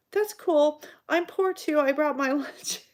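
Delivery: high, bright self-quote with a laugh; chirpy quote. Retold or imitated. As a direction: play your younger self, upbeat. Her chirpy self in retold chat.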